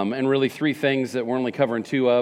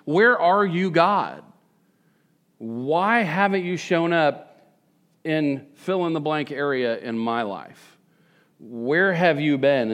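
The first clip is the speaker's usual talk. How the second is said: slow pleading prayer; slower than usual. Retold or imitated. Pastor voicing prayer to God.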